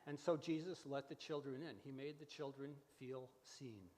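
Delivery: ordinary talk, nothing marked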